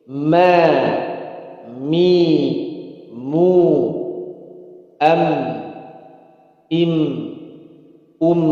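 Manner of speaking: Ma, mi, mu, am, im, um with the M humming briefly on; steady, clear syllables; mim drill. Shown as a demonstration. Teacher demonstrating mim syllables for students. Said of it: "Ada jalan sedikit juga sama".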